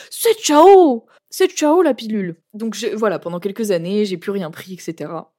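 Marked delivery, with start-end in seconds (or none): 0.1-2.4 high, loud bye-bye; triumphant goodbye. Retold or imitated. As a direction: shout it gleefully. Quitting the pill triumphantly.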